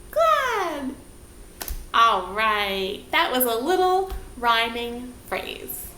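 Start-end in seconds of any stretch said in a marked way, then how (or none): 0.1-0.9 glad sung out high and falling. Shown as a demonstration. Teacher calling the glad word high for the class. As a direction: leap up on glad. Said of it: "try it with me".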